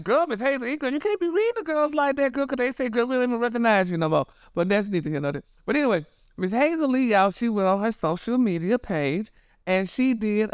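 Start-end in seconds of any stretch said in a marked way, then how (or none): 0.0-4.3 put-on high-pitched, exasperated girlfriend scolding; mock scolding. Gossip host telling Miss Hazel off, mock scolding. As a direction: chide her in a high, insistent voice.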